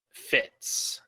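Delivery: ordinary talk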